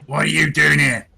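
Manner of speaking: mock-menacing disguise; disguised voice. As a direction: challenge them in a lightly put-on stranger's voice. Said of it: "try and disguise my voice".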